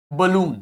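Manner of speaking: "बलून" with a full oo in the second syllable; short weak first vowel, long oo in "बलून". Shown as a demonstration. Teacher showing students the schwa in balloon, "बलून" with full oo. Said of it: "ओ को आपने पूरा ऊ pronounce किया".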